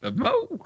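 "Bevmo!" squeaked very high but soft; a high, breathless "Bevmo!" echo; mock exclaimed Bevmo. Shown as a demonstration. Echoing the exclaimed brand name.

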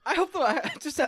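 normal talking voice

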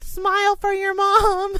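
put-on high, loud, slow kid command; bossy little kid. Classmate ordering her son to smile for the camera, high and loud. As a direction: boss the other kid into smiling, loud and high. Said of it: "and was like".

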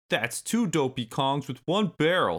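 high-pitched taunting creature voice; smirking villain. A narrator's reading. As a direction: jeer at them with a smirk. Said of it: "smirked one of the Kremlings".

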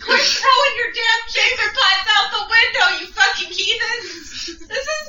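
high-pitched, ranting mock yell; hammed-up indignant street shouting; outraged passerby. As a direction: berate someone at an upstairs window. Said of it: "Some guy's walking by, he just goes".

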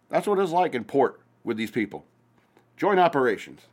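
ordinary talk

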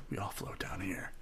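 hushed, whispered villain line; creepy clown whisper. Acted.

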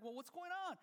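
asking what the noise is about; a lightly voiced confused son; puzzled son. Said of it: "And like".

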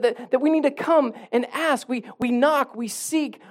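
high, driving sermon voice; impassioned preaching. Real, not acted. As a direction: exhort the congregation with rising urgency. Preaches at raised pitch.

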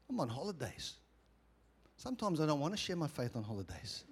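reluctant prayer. Retold or imitated. Holidaymaker telling the Lord he wants a break, soft reluctance. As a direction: quietly beg off, as an aside to God.

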